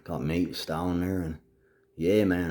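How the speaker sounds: normal talking voice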